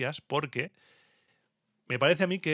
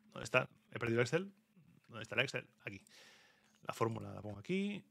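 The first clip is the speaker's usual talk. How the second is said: muttering to himself; 11 dB quieter, faster than usual. Real, not acted. Searching the spreadsheet mid-show.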